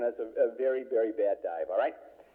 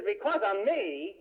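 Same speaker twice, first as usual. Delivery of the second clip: slow, higher-pitched mock disbelief; indignant father; 6 semitones higher, slower than usual. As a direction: imitate an affronted father stretching out the question. Accused father retorts indignantly.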